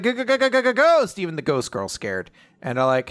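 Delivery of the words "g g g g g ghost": stammering terror. Retold or imitated. The group spots the ghost, mock-terrified stammer. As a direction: sputter in mock fright before blurting it.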